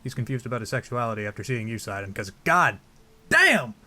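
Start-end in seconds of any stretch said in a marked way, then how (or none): 2.4-3.7 high, loud mock-lustful outburst; emphatic exclamation. Player reacting to seeing Sidon, high loud outburst. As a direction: burst out much higher and louder.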